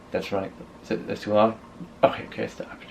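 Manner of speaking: listen in the dark, talk under your breath; anxious night listening. Retold or imitated.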